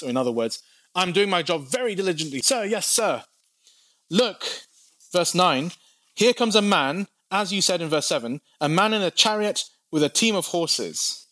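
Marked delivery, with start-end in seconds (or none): 0.9-3.2 higher, quick, eager yes-sir soldier. Acted. Dutiful watchman to boss. 4.1-4.7 lookout's call. Acted. Lookout spots a chariot. 6.2-11.3 lookout's report. Acted. Lookout reports chariot approaching.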